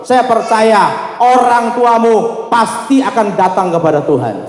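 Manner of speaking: rousing sermon charge, stretching key words; fervent preaching. Real, not acted. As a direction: preach it high and insistent. Preaches exhortation at full force.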